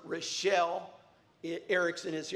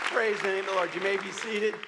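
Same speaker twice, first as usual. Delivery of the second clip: ordinary delivery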